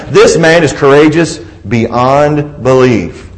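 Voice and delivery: ordinary talk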